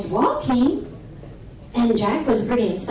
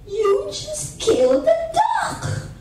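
high, slow acted shock; shocked accusation; 13 semitones higher, slower than usual. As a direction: accuse your brother in high-pitched disbelief. Sister accuses brother in the story.

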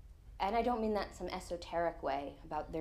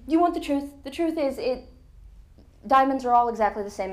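higher and louder, finally snapping; fed-up outburst; 6 semitones higher, 9 dB louder than usual. Retold or imitated. To the pestering husband in the bar, a fed-up outburst. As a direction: blurt it out, nerves frayed, done with the questions.

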